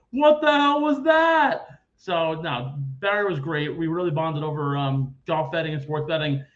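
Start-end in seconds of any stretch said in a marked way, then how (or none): 0.1-1.6 much higher, louder and slow; mock astonished cry; retold stunned outcry; stunned disbelief. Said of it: "we were like".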